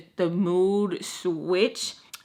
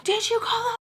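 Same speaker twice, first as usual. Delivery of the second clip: much higher mock-angry question; mad question. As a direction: demand it sharply. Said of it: "Like, mad".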